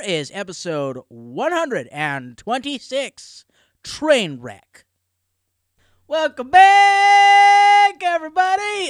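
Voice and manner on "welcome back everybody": drawn-out, high, loud greeting; announcer welcome. Acted. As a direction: stretch the welcome like a showman. Big show opening greeting.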